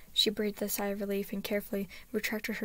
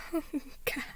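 tearful whimper; 6 semitones higher than usual. A narrator's reading. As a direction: break down, barely getting the words out. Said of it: "she whimpered".

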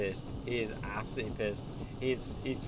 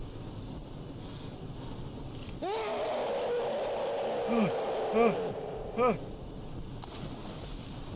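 panicked gasp; 3 dB louder than usual. Real, not acted. Fleeing the monster.

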